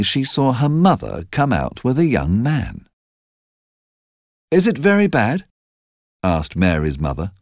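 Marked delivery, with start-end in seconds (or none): 4.5-5.5 high, anxious question; mother's worried question. A narrator's reading. Narrator voicing Mary's mother, anxious. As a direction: ask in fear about the sickness.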